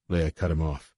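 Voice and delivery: plain reading voice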